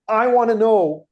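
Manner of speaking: imitating an angry heckler; retold yell. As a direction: start shouting at the speaker. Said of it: "he starts yelling at me".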